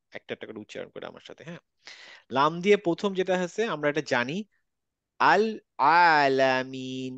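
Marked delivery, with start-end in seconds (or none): 5.2-7.1 আল আলামিন very slow, each ল clear; clear lam, slow. Shown as a demonstration. Teacher reading slowly for the class, clear lam. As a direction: draw out আল আলামিন with a clean l. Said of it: "লামের উচ্চারণ clear".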